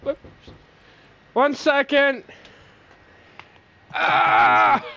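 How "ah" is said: loud high scream; startled loud scream; sudden yell. Real, not acted. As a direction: cry out in sudden alarm. Yells in game.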